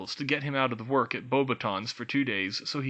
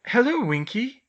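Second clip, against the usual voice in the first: worried; 12 semitones higher, 7 dB louder than usual. A narrator's reading. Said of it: "Hermione said worriedly".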